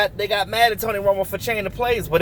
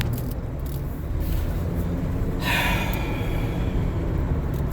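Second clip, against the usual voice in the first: long weary sigh; 7 dB quieter than usual. Real, not acted. Resigned about Dez's release.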